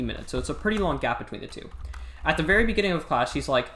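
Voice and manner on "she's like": usual speaking voice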